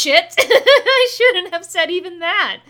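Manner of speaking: high, loud, slow flustered blurt with laughter; panicked laugh. Retold or imitated. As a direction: blurt out caught in a slip. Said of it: "And Deku's like".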